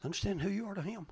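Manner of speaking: ordinary delivery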